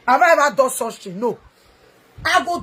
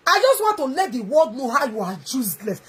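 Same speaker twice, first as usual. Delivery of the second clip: normal talking voice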